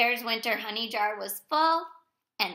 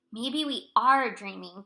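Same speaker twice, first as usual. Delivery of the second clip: plain reading voice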